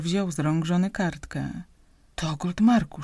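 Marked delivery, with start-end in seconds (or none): none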